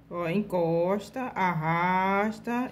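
each step drawn out slowly; slow step-by-step demo. Shown as a demonstration. Teacher slowly calling the steps to her students. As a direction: spell out the stroke slowly for students. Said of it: "demonstrating the brush stroke slowly".

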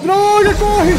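high, loud urgent shout; frantic warning to run; being chased. Real, not acted. Friend urging flight from monster.

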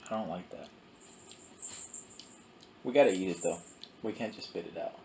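chewing while talking; eating sour candy; mouth full of candy. Real, not acted. Seen 0: Both hold candy and bring it to their mouths; the speaker is unclear.

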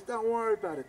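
high, loud brush-off; insistent dismissal. Retold or imitated. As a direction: act out waving away an objection. Acted dismissal of the worry.

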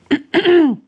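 colpo di tosse per schiarire la voce; si schiarisce forte la gola; schiarirsi la gola. Real, not acted. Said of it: "ho qualcosa in gola". (schiarita di gola acuta e decisa)

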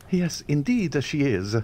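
put-on young man feigning modesty about his bride; very modest. A narrator's reading. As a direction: play at modesty. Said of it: "in a very modest tone".

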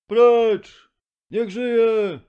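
very high, loud crowd yell; crowd shouting. A narrator's reading. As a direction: hurl it from the back of the hall. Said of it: "wołano na sali".

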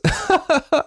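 a merry falling laugh; high laugh. A narrator's reading. Parents laughing at their daughter, high chuckle. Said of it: "Aber die Eltern lachten".

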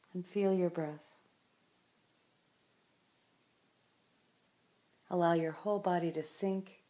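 unhurried meditation voice with long silences; calm, spacious guiding of a resting listener; guided meditation. Acted. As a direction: guide the listener gently into stillness, leaving space after each phrase.

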